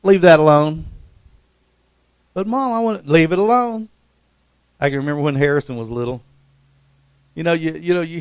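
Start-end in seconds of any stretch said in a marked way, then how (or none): 0.0-0.8 loud mock-mother scolding; a mother snapping at a child to leave it alone; mom's warning. Preacher imitates a mom scolding her child, loud and sharp. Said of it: "your mom would say". 2.3-3.9 put-on whiny kid. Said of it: "child's reply".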